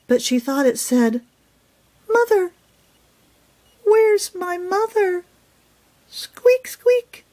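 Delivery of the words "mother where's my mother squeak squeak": very high tiny squeaking voice; squeaky baby mouse. Acted. Lost baby mouse calling for her mother, very high squeak. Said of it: "The tiny mouse squeaked".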